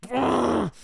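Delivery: high, drawn-out angry groan; hammed-up wordless snarl; hostile growl. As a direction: growl like a mock hangry monster. Boyfriend turns hostile at six.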